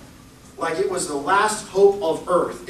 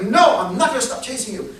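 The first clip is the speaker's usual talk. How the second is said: defiant refusal; 5 semitones higher, 4 dB louder than usual. Acted. Acted refusal by the pursuer.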